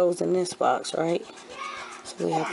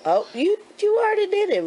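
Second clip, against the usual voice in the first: surprised; 4 dB louder, faster than usual. Real, not acted. Child finished before help.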